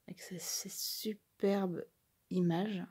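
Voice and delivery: breathless little whisper over the pretty images; hushed and slow, as if afraid to spoil the moment; awed whisper. Real, not acted. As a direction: whisper it slowly in admiration. Admiring the card images.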